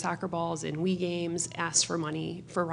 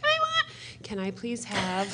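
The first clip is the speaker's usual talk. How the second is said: put-on whiny little girl; 6 semitones higher than usual. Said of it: "she'll be like".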